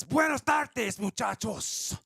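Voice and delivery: high, loud, exaggerated Spanish accent; put-on Spanish accent. Quoting an event guest's greeting, hammed-up Spanish accent. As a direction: call it out in a big mock Spanish accent. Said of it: "I don't know what accent was".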